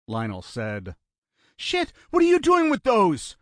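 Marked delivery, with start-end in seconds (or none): 1.6-3.3 very high, loud alarm; panicked. A narrator's reading. Brother seeing what the gunman grabbed, panicked. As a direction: cry out in sudden fright. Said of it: "Panic had returned to his voice".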